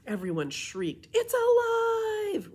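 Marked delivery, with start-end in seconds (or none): 1.1-2.5 much higher and louder, slow and drawn out; terrified shout. Acted. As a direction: scream it like a horror film. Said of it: "Robert shouted".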